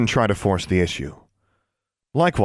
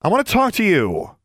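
insistent demand; 10 semitones higher, 3 dB louder than usual. A narrator's reading. Alexandra confronting Maximilian.

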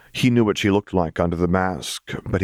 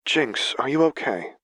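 higher, gentle check-in; concerned Lux; 7 semitones higher than usual. A narrator's reading. Lux checking on Jinx, gently concerned. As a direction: ask gently in a lighter voice.

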